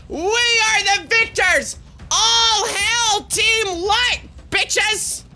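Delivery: high, slow grand declaration; mock victory proclamation. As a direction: proclaim it like a conquering king. Mock victory proclamation after win.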